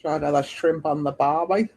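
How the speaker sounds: put-on Aussie accent on the barbie line; Australian accent. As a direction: slip into a broad Australian accent. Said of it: "Are you doing an Australian accent?".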